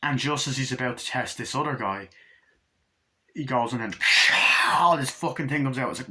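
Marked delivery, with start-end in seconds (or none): 4.0-4.7 loud, hissing burst; voiceless explosive screech; imitated creature shriek. The thing bursting out of the blood, imitated shriek.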